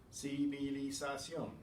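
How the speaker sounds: Civilización slowly, ci-vi-li-za-ción; measured, syllable-by-syllable Civilización; every syllable separate. Shown as a demonstration. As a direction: give each syllable of Civilización its own beat. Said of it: "Every syllable is separate".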